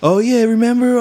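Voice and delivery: high-pitched mock-excited yelling over music; excited over loud music. Stoned friend blurting out over loud music, mock excited. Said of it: "we were really excited, loud music".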